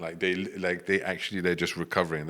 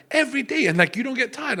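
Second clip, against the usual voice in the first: very high, louder astonished questions; amazed disbelief; 5 dB louder than usual. Retold or imitated. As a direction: squeak out the friends' amazement. Retold friends amazed at claim.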